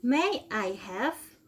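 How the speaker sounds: slow, clear drill phrases for students to repeat; teacher model reading. Shown as a demonstration. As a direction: model each phrase slowly for the class to copy. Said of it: "Oke, one more time dari atas".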